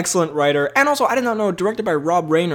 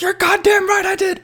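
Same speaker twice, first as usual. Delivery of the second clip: much higher, mock-angry shout; Jack Nicholson outburst. Nicholson's character cornered in court, hammed-up defiant shout. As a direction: burst out with a hammed-up courtroom confession. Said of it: "when Nicholson's like".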